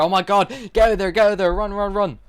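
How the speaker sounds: yelling at his daughter to run; panicked dad. Retold or imitated. Father on FaceTime yells at daughter to flee, mock panic. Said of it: "he's jumping around in his chair".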